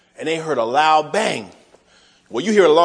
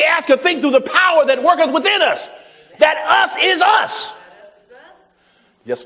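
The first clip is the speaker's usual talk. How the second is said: raised preacher's voice with shouted peaks; preaching; 5 semitones higher than usual. Acted. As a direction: preach each proof with rising force. Preacher listing God's power.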